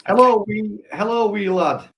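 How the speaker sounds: a rough, lightly put-on Scottish accent, up high. Reading a viewer's chat greeting in a mock Scottish accent. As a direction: try a Scottish greeting, hammed up. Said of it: "Did that sound Scottish?".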